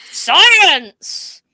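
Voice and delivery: high, loud, drawn-out mock battle cry; hammed-up shout, pitch swooping up then falling; mock-dramatic exclamation. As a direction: belt it out with playful grandeur. Playful echoed exclamation of science.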